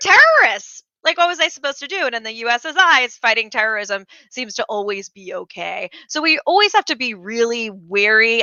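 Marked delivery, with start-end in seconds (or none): 0.1-2.1 higher, louder mock-innocent excuse; whiny fake-innocent tyrant voice; mocking Assad's excuse. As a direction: play the dictator shrugging off arrests as innocent. Mock Assad justifying arrests.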